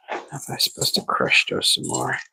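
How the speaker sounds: whispered aside to himself; whispering. Real, not acted. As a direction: breathe it out under your breath. Muttering to himself while cooking.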